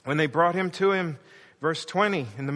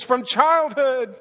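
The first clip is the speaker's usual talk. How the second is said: anguished dad; 6 semitones higher, 5 dB louder, slower than usual. Acted. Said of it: "the dad says".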